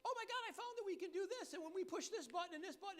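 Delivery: high, bubbly imitation of an excited lady; giddy old bookkeeper. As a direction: gush like she just found a treasure. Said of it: "she calls me all giddy like".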